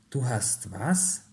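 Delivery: slow echo question, lifting on "was"; incredulous question. A narrator's reading. Parents react to their son's invitation, incredulous. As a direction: draw it out in disbelief. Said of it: "fragte seine Mutter und sein Vater".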